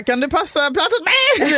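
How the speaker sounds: eager request. Retold or imitated. Asking the bus leader to save her a seat, eager and high. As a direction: press the favour out in a rush.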